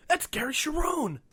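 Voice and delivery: high, loud, drawn-out squeal; retold excited fan. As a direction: imitate a starstruck fan spotting an idol. Retold fan spotting her idol.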